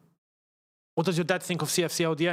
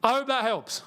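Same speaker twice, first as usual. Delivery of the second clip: raised pitch, falling at the end; higher-pitched sign-off; 5 semitones higher than usual. Pretend. Sign-off repeated in another voice.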